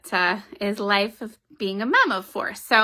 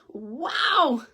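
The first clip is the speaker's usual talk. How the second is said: very high amazed cry; over-the-top proud mother; amazed mom; 10 semitones higher, slower than usual. Acted. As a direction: squeal with pride at the drawing. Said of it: "Her mother said".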